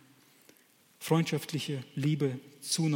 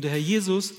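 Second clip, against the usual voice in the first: usual speaking voice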